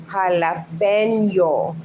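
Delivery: jalapeno as ha-la-PEN-yo, Spanish accent, slow; Spanish pronunciation. Shown as a demonstration. Correcting Luann, jalapeno slowly in Spanish accent. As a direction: draw out jalapeno with pure Spanish vowels.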